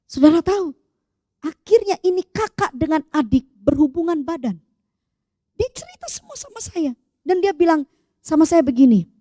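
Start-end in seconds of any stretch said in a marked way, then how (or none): 5.6-6.9 high, very soft voice; hushed disclosure. Real, not acted. Hushed recounting of a confession. (lirih dengan nada tinggi)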